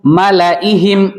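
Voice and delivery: मलाइहिम slow, with the alif after ला left silent; slow model reading. Shown as a demonstration. Teacher showing pupils the silent alif. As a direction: read मलाइहिम slowly, no alif sound. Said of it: "देखो".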